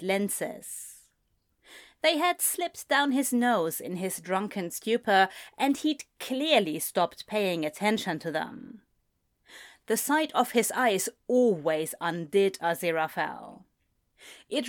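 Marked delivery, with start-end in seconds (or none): none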